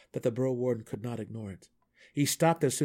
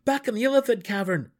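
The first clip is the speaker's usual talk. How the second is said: high, loud reminder; urgent protest; 12 semitones higher, 8 dB louder than usual. A narrator's reading. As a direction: insist he remembers. Gnome protesting.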